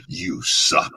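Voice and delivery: very high, sneering soft taunt; mock the beaten fighter; scornful villain jeer; Shao Kahn taunt. Shown as a demonstration. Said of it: "the ultimate taunt is".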